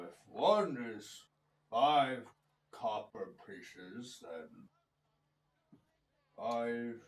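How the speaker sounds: low, slow, dim-witted giant counting; gruff cyclops. Acted. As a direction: mumble the sums like a slow giant.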